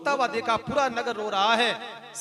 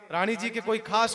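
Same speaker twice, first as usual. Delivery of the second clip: ordinary talk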